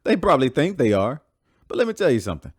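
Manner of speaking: scoffing retort. Acted. Heated argument, scoffing reply.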